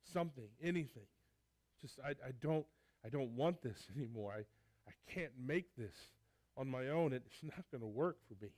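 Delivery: raw, loud, halting confession of a son who has failed; pleading son, earnest and strained; broken son pleading. Acted. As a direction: pour out the guilt, voice breaking off. Preacher voicing the broken son.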